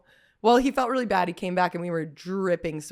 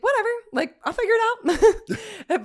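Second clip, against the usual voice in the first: high carefree; 13 semitones higher than usual. Retold or imitated. Friend out of gas, high breezy mock. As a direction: shrug it off airily. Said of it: "she was like".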